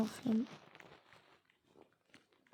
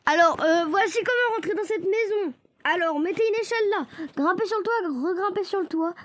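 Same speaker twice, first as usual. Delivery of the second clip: higher, upbeat guide to viewers; tutorial voice; 6 semitones higher than usual. Acted. Gamer showing viewers how to enter the house, upbeat host voice.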